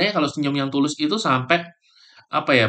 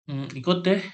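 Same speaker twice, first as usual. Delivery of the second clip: half-hearted yes; 6 semitones higher, 6 dB quieter than usual. Acted. Friend accepting a holiday trip invite, half-heartedly. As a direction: agree without any real interest. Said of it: "kalau misalnya nadanya rendah".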